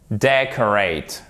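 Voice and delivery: slow "Decorate", DEC stressed; stress on first syllable. Shown as a demonstration. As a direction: stretch it, leaning on DEC. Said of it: "accent tonique sur la première syllabe". (« Decorate » lentement, accentué sur DEC)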